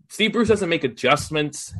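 ordinary talk, nothing marked

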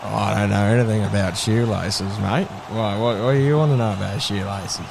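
very low, monotone player voice; deep-voiced bloke, puzzled and evasive; cagey footballer dealer. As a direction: answer warily in a deep drone. Plays mic'd-up player asked about shoelaces.

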